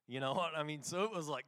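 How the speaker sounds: ordinary talk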